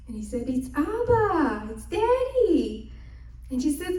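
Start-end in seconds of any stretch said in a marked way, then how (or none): 0.5-2.9 a hint of father. Father at the door.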